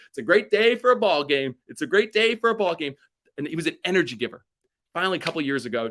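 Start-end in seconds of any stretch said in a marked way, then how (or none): 0.1-3.0 loud, high, beaming greeting; bright, smiling game-day call; cheerful Reggie. Retold or imitated. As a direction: sing it out like the happiest man at the ballpark. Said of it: "with a big smile and say".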